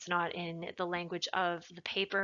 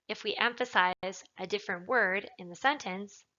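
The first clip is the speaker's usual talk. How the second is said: ordinary talk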